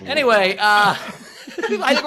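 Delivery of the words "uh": usual speaking voice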